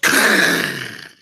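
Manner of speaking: loud unvoiced burst of noise; imitated door noise. Storyteller imitating the door an hour later, loud vocal sound effect. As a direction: make the door sound with your mouth.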